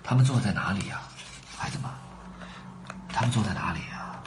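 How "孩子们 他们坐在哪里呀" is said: soft unvoiced whisper. Real, not acted. Reading to children, whispering a question to them.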